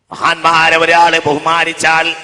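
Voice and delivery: loud, slow preaching; ringing sermon voice; full-force preaching. Acted. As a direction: preach it to the hall.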